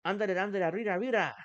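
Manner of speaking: mock cartoon cheer; Speedy Gonzalez. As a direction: hurry someone along like Speedy Gonzalez. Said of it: "Like Speedy Gonzalez".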